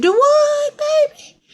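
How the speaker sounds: very high, loud squeal of disbelief; shocked Mama Bear. Acted. Mama Bear questions her baby, high shocked squeal. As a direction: shoot way up in pitch, astonished.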